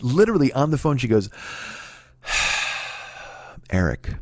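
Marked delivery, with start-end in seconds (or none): 2.3-3.0 long, soft exhaled sigh; imitated sigh. Girlfriend cornered about who she was with, reluctant sigh. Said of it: "you sighed and said". 3.7-4.1 low, soft, sighed-out name; reluctant admission. Retold or imitated. Girlfriend finally admitting who she was with, reluctant and low. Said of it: "you sighed and said".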